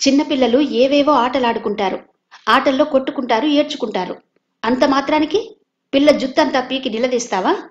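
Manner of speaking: angry scolding. Acted. Savitri scolding Madhu for pulling her daughter's hair, angrily. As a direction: tear into him for hurting her child. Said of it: "Savitri కోపంగా".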